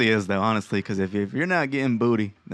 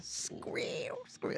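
pig squeal; 17 dB quieter than usual. Pretend. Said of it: "Squeal like a fucking champion".

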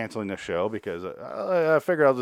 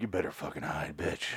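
hushed, lower menacing threat; low quiet threat; 6 semitones lower, 9 dB quieter than usual. Retold or imitated. Ben threatens Constance after learning the truth, low quiet menace. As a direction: growl it softly as a mild mock threat. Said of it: "He's like".

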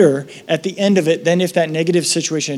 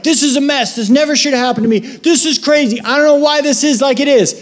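much higher, exasperated voice; mock despair, raised pitch; frustrated complaint; 8 semitones higher, 3 dB louder than usual. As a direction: vent at the mess. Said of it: "you're like".

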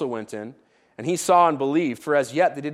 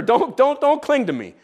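higher, louder urgent repeated don'ts; urgent plea; 8 semitones higher, 3 dB louder than usual. Acted. Jesus urgently telling Mary not to cling, higher and louder. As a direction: stop her urgently but kindly.

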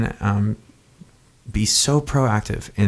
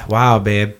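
ordinary talk, nothing marked

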